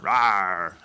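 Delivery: a loud, joking bear growl; bear roar. Pretend. Joking that they are bears now.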